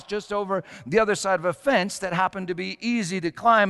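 normal talking voice

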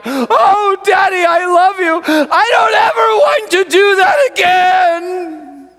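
high, loud sobbing cry; daughter crying out. Retold or imitated. Daughter to her father after running away, loud tearful cry. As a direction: cry out to Daddy through tears. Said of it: "she cried out".

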